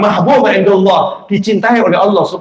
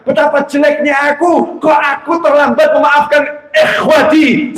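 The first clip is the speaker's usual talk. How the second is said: anguished cry; 6 semitones higher than usual. Acted. Ulama laments his late forgiveness.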